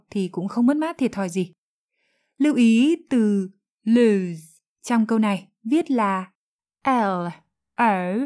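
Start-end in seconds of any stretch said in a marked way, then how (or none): none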